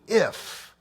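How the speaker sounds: "if" held up as a questioning, mocking query; stress on if. Shown as a demonstration. Preacher mocking the husband's "if" to the congregation. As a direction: question the word "if" with scorn. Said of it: "what do you mean".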